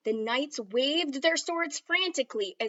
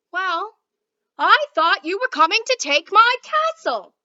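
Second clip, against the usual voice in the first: much higher, louder flustered explanation; defensive shout; 8 semitones higher, 13 dB louder than usual. Acted. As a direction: explain yourself loudly, caught out. King defends himself loudly.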